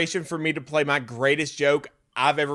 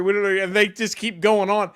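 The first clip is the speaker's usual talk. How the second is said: usual speaking voice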